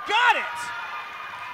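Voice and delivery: high, loud excited shout. Real, not acted. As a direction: yell it as the shot drops. Play-by-play after a long three.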